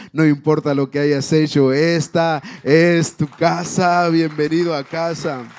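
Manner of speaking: proclamado fuerte, como un padre que abraza al hijo; padre que acoge en voz alta. Acted. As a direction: levanta la voz y recibe al hijo perdido. (voz fuerte de padre que da la bienvenida)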